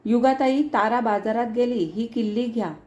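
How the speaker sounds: plain reading voice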